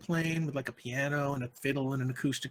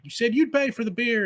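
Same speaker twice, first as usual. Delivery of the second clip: angry accusation; 7 semitones higher, 5 dB louder than usual. Acted. Band members quarreling.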